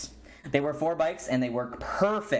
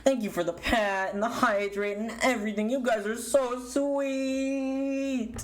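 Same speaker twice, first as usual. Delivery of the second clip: sweet thanks; 7 semitones higher than usual. Real, not acted. Thanking subscribers.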